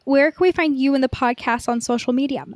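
normal talking voice

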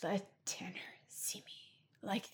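slow unvoiced hushed plea; mock secret whisper. Imitating Toby hiding from Tanner, in a slow whisper. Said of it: "he's like".

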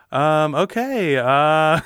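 slow, high hesitant host; awkward stalling. Retold or imitated. Host on stage watching a brawl, awkward hesitation. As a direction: stall helplessly as a fight breaks out. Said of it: "Matt's just standing up there going".

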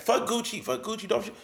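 ordinary talk

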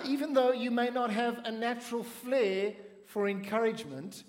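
usual speaking voice

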